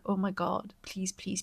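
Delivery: ordinary delivery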